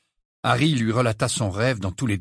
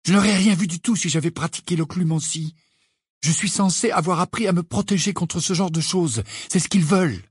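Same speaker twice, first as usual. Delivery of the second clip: high, insistent, vexed; irritated self-justification; 8 semitones higher than usual. A narrator's reading. Harry explaining why he must tell nobody, irritated. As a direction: press the point with raised pitch.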